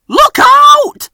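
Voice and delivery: alarmed cry. A narrator's reading. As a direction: cry out as rocks fall. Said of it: "wheezed Fergus".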